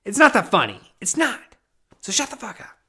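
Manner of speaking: a hammed-up rant at a joker; mock outburst. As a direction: blow up in fake outrage. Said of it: "I'm trying to be funny".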